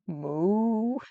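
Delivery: a lightly voiced mock "Moo"; cow moo. Shown as a demonstration. Grandma copies the cow for the child, a mild moo. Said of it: "your impression is much better than hers".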